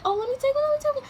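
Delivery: high, loud begging voice; pleading like a greedy kid for a bag; mock eager Travis. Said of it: "Travis was like".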